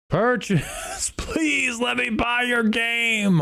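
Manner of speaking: exasperated plea. Real, not acted. Game purchase keeps failing.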